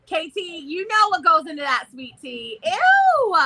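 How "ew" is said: high, loud squeal of disgust. Real, not acted. Telling K T about sweet tea, disgusted squeal. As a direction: recoil with a high-pitched yuck.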